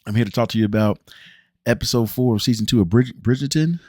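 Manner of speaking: loud announcer voice; show host intro. Acted. Vlogger opening his next episode review like an announcer. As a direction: announce yourself to the audience, big and loud.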